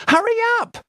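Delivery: very high panicked shout. Acted. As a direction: yell at her friend. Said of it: "she shouts".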